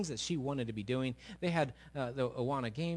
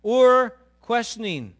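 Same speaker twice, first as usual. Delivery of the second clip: slow emphatic reading; 10 semitones higher, 13 dB louder, much slower than usual. Shown as a demonstration.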